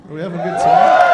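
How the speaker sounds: high, loud call to crowd; loud crowd hype. Real, not acted. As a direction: rev up the audience, shouting. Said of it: "crowd work shout".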